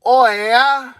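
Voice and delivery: high, hammed-up Canadian accent; Canadian impression. Ranter going full Canadian mode to sign off, hammed-up Canadian accent. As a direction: stretch it out in a mock Canadian voice. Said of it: "That's like a Canadian, a Canadian".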